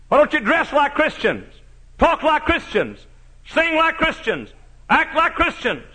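high, loud preacher's cry; fiery preaching. Acted. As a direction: hammer it home from the pulpit. Preaching at full force.

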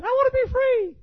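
very high little-dog voice begging; squeaky high dog voice pleading; talking Chihuahua. Acted. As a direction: whine to get out like a caged lapdog. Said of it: "Chihuahua said".

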